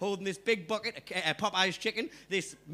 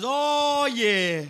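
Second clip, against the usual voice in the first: higher, louder sly recognition; knowing hustler; 5 semitones higher, 6 dB louder than usual. Retold or imitated. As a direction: lean in like you've got him figured out. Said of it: "And he goes".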